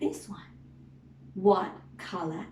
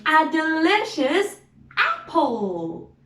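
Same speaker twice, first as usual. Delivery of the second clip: excited; 7 semitones higher, 4 dB louder than usual. Acted. Excited about the apple.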